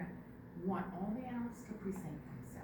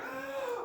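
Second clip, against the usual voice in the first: sharp high gasp; 14 semitones higher than usual. Acted. Monkey seeing the shrunken fur, gasping. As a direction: gasp in surprise.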